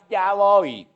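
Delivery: quick dutiful reply; obedient child. Pretend. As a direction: answer at once, obediently. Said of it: "Vaya que usted diga".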